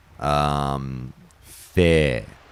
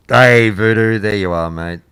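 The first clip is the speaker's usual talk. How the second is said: loud, raised greeting; calling out; 6 semitones higher, 12 dB louder than usual. Real, not acted. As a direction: hail them loudly as they turn up. Greeting a viewer who turns up.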